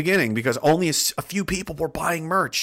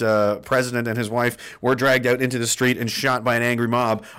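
ordinary delivery

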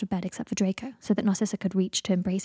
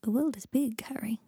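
quiet and gentle; 3 semitones higher, 4 dB quieter than usual. Acted. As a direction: say it softly, letting him down easy. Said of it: "said Draco quietly".

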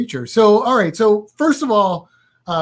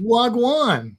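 high copy of a Jamaican "Wagwan"; trying out Jamaican patois; Jamaican greeting imitated; 5 semitones higher than usual. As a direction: echo the Jamaican greeting back. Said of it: "in Jamaican".

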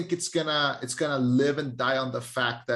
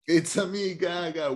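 higher mock Mario "It's a me, [name]"; Mario impression; 4 semitones higher than usual. Friend echoes the Mario joke in mock Mario voice. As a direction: echo the Mario catchphrase. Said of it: "And she said".

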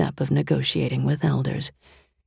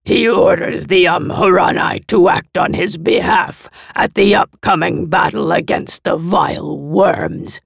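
louder, higher voice issuing commands with contempt; arrogant monster envoy; 4 semitones higher, 6 dB louder than usual. A narrator's reading. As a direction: order them about like lesser beings. Arrogant monster envoy gives orders.

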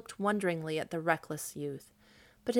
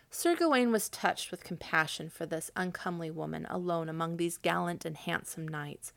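plain reading voice

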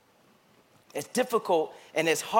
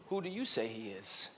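hushed question; 9 semitones lower, 15 dB quieter than usual. Acted. Preacher asking Renaissance the question, hushed and soft. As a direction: drop to almost nothing, much softer, intimate.

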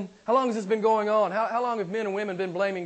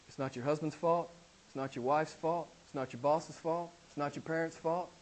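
softer, lower pastoral plea; quiet earnest appeal; 4 semitones lower, 8 dB quieter than usual. Real, not acted. Preacher quietly urging the congregation to own their sin. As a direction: press it home gently but firmly.

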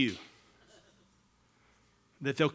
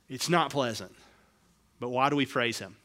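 normal talking voice

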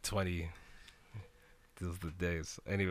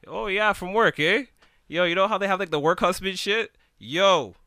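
higher, louder sarcastic jeer; sarcastic mock; 10 semitones higher, 8 dB louder than usual. Telling friends how he scoffed at her work excuse, loud sarcasm.

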